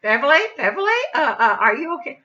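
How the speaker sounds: imitated concerned caller, urgent; Maddie. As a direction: call anxiously down the phone. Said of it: "I can hear Maddie saying".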